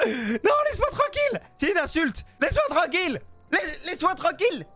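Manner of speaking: high-pitched hammed-up panicked yelling; mock panic. Seller defending himself from an insulted customer, mock panic. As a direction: fend off an attacker in comic panic. Fends off hostile customer in game.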